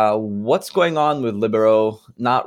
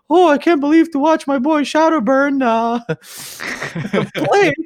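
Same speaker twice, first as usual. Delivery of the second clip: much higher gushing fanboy voice; excited fan voice. Retold or imitated. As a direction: gush like a starstruck fan. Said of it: "you would constantly see".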